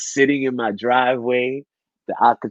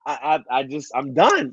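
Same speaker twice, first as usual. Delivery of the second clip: ordinary delivery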